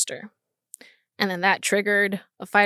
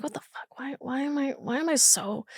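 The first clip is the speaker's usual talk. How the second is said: hushed self-question; 4 semitones higher, 10 dB quieter than usual. Retold or imitated. Crying, asks herself why.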